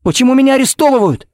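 very high, distraught pleading; desperate protest. Acted. Father protesting his arrest, desperate high-pitched pleading. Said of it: "не унимался Коннорс".

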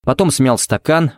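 ordinary delivery

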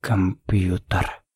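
very slow, softened near-whisper; stunned whisper. Acted. Shocked father to the officers, stunned near-whisper. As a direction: breathe out the word slowly, barely managing it. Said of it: "прошептал Коннор с непослушными губами".

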